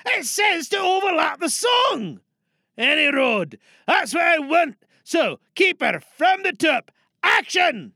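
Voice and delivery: loud, high orders; bossy director shout. Acted. Director shouting the cast back to the top. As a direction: bark out the restart.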